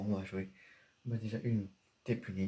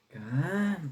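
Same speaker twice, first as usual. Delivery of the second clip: calm down; 7 semitones higher, 7 dB louder than usual. Acted. Son calming angry father.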